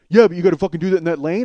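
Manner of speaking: loud, rushed retort; angry arguing. Retold or imitated. Passenger objects to the blocked lane, angry and rushed. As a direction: argue back fast and heated.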